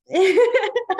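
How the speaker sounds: high, loud burst of laughter; amused laugh. Real, not acted. Laughs at own story.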